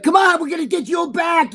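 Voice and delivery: high, shouted imitation; mock shout rounding someone up; George shouting orders. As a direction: yell it as the no-nonsense agent. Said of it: "And he'd be like".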